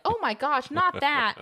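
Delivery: high, scandalized mother; mock shock. Schwartz's mom hearing the bad word, mock shock. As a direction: recoil in hammed-up horror.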